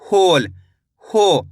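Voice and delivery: "होल", then only its long "हो"; drawn-out dictation of "होल" and "हो"; careful pronunciation. Shown as a demonstration. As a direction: sound out "होल", then isolate the "हो". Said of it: "कैसे हो रहा है?".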